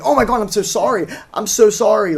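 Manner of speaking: high, hurried mock-frantic apology; imitated breakdown. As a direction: imitate someone falling apart apologizing. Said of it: "he's, like, freaking out, having, like, a breakdown".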